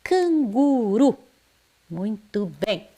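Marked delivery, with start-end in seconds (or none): 0.0-1.2 "Canguru" devagar, R de um toque suave; R fraquinho. Shown as a demonstration. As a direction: alongue "Canguru" e deixe o R leve. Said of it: "eu falo ele fraquinho". (erre fraquinho em "Canguru")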